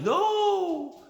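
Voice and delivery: a squeaky high mock of his wife saying no. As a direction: toss off her refusal in a high, mocking voice. Mocking the wife's refusal.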